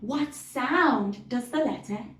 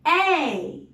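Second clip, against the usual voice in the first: ordinary talk, nothing marked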